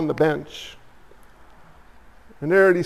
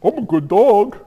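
a hint of an innocent pet pleading its case; voicing the dog. The family dog excusing its toilet drinking, lightly voiced. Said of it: "That's the desires of the flesh".

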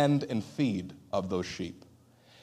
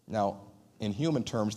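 ordinary talk

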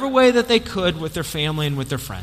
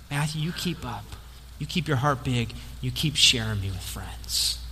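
tender encouragement; 6 semitones lower, 9 dB quieter than usual. Acted. Jesus encourages Matthew at the end.

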